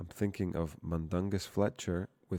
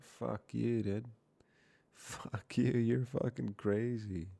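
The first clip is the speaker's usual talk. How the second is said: mock outrage; 5 semitones higher, slower than usual. Real, not acted. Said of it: "cursing at support prompt".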